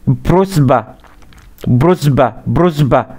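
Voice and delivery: بروسبا said as bruzba. Shown as a demonstration. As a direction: soften the s of بروسبا toward z. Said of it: "نطق فيها زين شويه".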